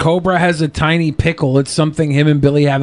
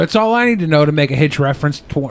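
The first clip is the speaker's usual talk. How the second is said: normal talking voice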